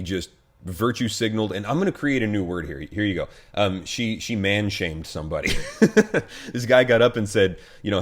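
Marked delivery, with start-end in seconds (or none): 5.6-6.5 burst of laughter. Real, not acted. Laughing at own new word.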